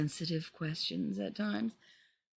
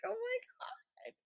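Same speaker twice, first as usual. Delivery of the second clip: soft high squeaky gasp; squeak; 10 dB quieter than usual. Retold or imitated. Faltering in front of the parents, soft high squeak. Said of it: "And I squeak".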